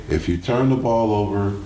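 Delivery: normal talking voice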